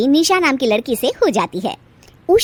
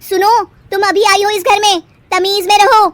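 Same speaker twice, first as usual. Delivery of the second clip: louder, higher threat; threatening sister-in-law; 8 semitones higher, 5 dB louder than usual. Acted. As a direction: put her in her place.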